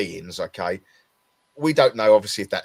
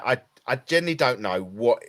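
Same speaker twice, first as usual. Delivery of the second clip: normal talking voice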